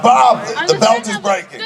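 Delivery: high-pitched retold alarm; alarmed calling down. Hanging actor warns stunt coordinator, imitated alarmed call. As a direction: call a warning down, mock panic. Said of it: "I start calling down".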